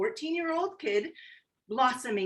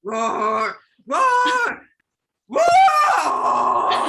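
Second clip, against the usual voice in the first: a high, loud shouted pretend roar; full lion roar; 6 semitones higher, 8 dB louder, much slower than usual. Pug finally roars loud like a lion for the child. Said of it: "Perfect. That's perfect".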